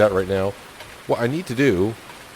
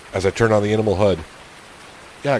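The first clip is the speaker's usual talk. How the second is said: ordinary talk, nothing marked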